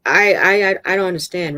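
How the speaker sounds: loud, halting repeats of I; distressed stammer. Acted. River shaking her head, distressed stammering. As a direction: stumble into it, upset.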